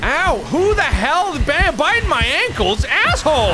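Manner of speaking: high, indignant yelp of complaint; pained outburst. Real, not acted. Bitten by a demon in game.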